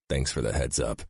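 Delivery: ordinary delivery, nothing marked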